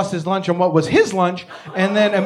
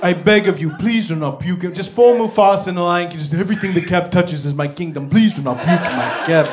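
put-on pleading African-accented cab driver. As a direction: beg in an old African cabbie's accent. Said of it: "say a big word in a funny accent".

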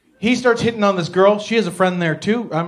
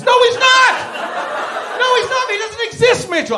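exasperated yell. Acted. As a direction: yell it in high-pitched exasperation. Yelling at Mitchell to stop.

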